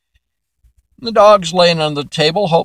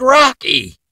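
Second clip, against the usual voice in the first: exasperated call; 10 semitones higher than usual. Acted. Dad scolds dog again.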